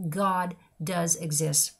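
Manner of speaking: "God does exist" drawn out very slowly, weight on "God"; slow it right down and let "God" land; slow emphatic. Real, not acted. Repeating to listeners that God exists, slow and weighty.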